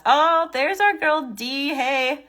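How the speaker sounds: light up as a friend walks in; excited greeting. Real, not acted. Host greeting a viewer who just joined, thrilled and loud.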